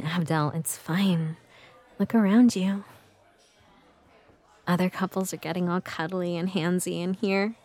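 normal talking voice

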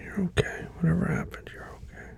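soft, whispered breathing coach; calming whisper. Acted. Reassuring partner.

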